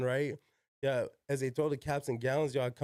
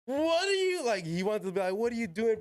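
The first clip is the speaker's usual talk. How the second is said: high, louder startled principal; shocked principal; 9 semitones higher, 3 dB louder than usual. Retold or imitated. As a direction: play the stunned principal, voice jumping up high. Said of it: "principal saw me and was like".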